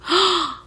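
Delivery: sharp horrified intake of breath; loud shocked gasp. Real, not acted. Reader hearing the kill order, loud horrified gasp. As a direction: gasp as if struck. King orders Chaol killed.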